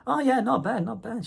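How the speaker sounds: high, casual small-talk voice; chatty shop assistant. Retold or imitated. Shop girl answering a customer, chatty.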